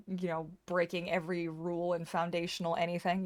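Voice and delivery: normal talking voice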